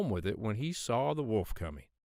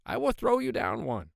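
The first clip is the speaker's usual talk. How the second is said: little pig voice; 12 semitones higher than usual. Acted. Pig in tree to wolf.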